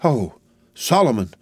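mild hammed-up cry of joy. A narrator's reading. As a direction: call his name with emotion. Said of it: "cried Miss Pross".

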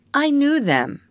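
put-on high, sing-out childish boast; annoying boast. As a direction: needle the others with it. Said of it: "to annoy the others".

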